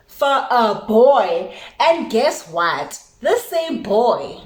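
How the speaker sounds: very slow, each word stressed; slow emphatic. Real, not acted. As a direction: drag out the punchline. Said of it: "slow emphatic punchline".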